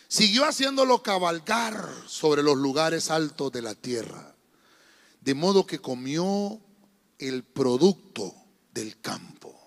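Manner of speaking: slow, measured Bible reading, phrases falling low; solemn scripture reading. Real, not acted. Pastor reading Deuteronomy to his church, solemn slow reading. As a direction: read the verse out deliberately, stretching key words. Reads scripture aloud to congregation.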